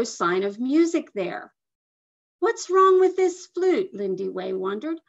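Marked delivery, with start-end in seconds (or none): 2.4-3.9 put-on high, louder girlish wondering; peering into the flute, baffled; puzzled girl. A narrator's reading. Girl puzzles over her silent flute, high and loud.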